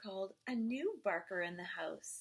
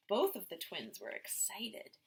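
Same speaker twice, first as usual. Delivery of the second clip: ordinary delivery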